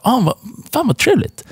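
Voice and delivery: squeaky, sarcastic nasty-sweet voice; high mock sarcasm. Girlfriend sneering sarcastically at her boyfriend, high mock voice.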